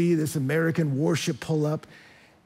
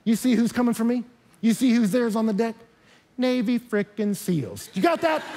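high, cocky taunting of the pirate; arrogant gloating; 6 semitones higher than usual. Pretend. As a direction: rub it in his face, much higher. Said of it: "He doesn't look at this pirate and go".

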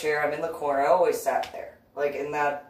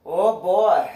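higher, louder through laughter; 7 semitones higher, 9 dB louder than usual. Real, not acted. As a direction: crack up on it. Laughing reaction.

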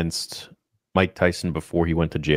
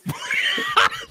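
high shrieking burst of laughter. Real, not acted. Watching slap replay.

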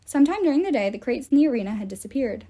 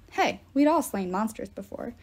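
ordinary delivery, nothing marked

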